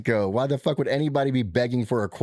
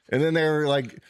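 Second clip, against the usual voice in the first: normal talking voice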